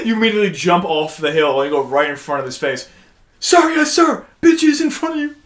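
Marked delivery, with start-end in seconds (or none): 3.4-5.4 high-pitched mock military sounding-off; hammed-up eager recruit voice; recruit reporting. Playing recruit standing before his superior, high mock sounding-off.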